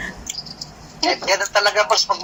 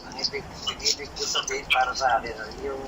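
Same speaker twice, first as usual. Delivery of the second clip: soft, low-volume explanation; softly spoken, hard to catch; too quiet to hear; 3 semitones lower, 11 dB quieter than usual. Real, not acted. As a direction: explain it under your voice, barely audible. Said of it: "lakasan mo yung boses mo".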